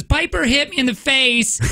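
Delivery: high, slow crybaby tattle; little boy faking tears to get his sister in trouble; whiny kid tattling. Retold or imitated. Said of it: "he starts crying and then says".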